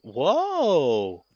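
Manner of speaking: long, loud, rising then falling whoa; surprised whoa. Real, not acted. Streamer spotting artwork in the game, loudly surprised. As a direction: react big to something on screen.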